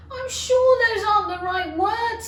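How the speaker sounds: a little choked and high; Alice near tears over the words; tearful. A narrator's reading. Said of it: "and she started to cry again".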